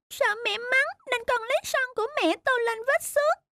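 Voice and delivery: put-on high, hesitant childlike confession; a small boy owning up, afraid of scolding; timid little boy confessing. Son confesses to mother he scratched her car, high timid child voice.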